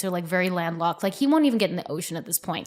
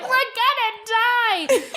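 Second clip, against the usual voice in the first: very high, slow, drawn-out cry; mock hysterical wail; much slower than usual. Mother imitating daughter again in the car, mock hysterics. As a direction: imitate a child wailing in doom. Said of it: "She's hysterical".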